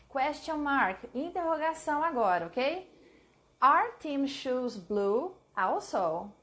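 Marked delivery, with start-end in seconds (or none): 3.6-6.3 slow dictation. Shown as a demonstration. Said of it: "I need a question mark".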